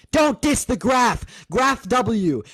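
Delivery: slow, drawn-out, high and loud; mock emphatic. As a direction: lean hard on each word, teasing. Mock slow graph chant.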